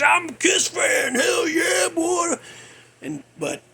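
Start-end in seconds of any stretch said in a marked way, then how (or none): 0.0-2.3 high, cheering "hell yeah"; fan cheer. Retold or imitated. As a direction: whoop it like a proud young fan. Voicing his younger fan self.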